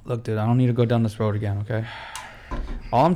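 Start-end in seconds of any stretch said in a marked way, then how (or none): none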